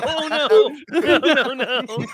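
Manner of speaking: high, panicked no no no; panicked refusal. Retold or imitated. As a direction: back away in horror. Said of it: "it's like".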